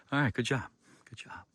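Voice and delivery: whispered good job; whispered praise. Real, not acted. Praising his tool, in a whisper. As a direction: breathe the praise to yourself.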